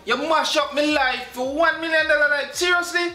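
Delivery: outraged disbelief. Acted. Husband confronting girlfriend over the million dollars, outraged high shout. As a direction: hurl the accusation up high.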